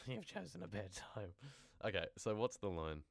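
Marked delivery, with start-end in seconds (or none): 0.1-1.3 higher put-on character voice; trial villain voice. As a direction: try out a menacing story character, pitched up. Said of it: "that was good, we can go from there".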